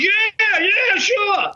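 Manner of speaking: high, eager imitation of the partner; mock the rookie's eagerness, pitched high up; eager yes. Partner eagerly agreeing to bust.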